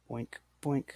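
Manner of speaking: two soft whispered boinks as letters drop. Pretend. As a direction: breathe boink boink like a tiny sound effect. Sound effect for moving letters.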